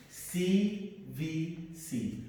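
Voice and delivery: slow, deliberate C V C; letter by letter for the class; slow spelling. Shown as a demonstration. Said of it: "teacher spells letters slowly".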